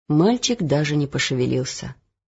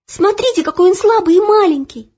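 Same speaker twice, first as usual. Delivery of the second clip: pleading; 13 semitones higher, 6 dB louder than usual. Acted. Girl pleading for boy.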